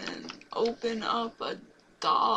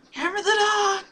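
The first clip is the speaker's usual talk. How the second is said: high, loud, sudden yell; loud shout; 10 semitones higher, 11 dB louder than usual. Real, not acted. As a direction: blurt it out as a shout. Shouting a game prompt.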